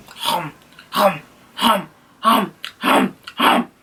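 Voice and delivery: repeated mock chomping noises; exaggerated rhythmic munching; greedy munching. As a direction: imitate gobbling popcorn noisily. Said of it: "He took my popcorn".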